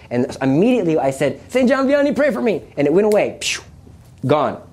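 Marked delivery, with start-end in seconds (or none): 1.5-2.6 high-pitched, urgent cry for help; urgent higher-pitched appeal to a saint; desperate plea. Retold or imitated. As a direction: re-enact a panicked prayer. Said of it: "it was in desperation".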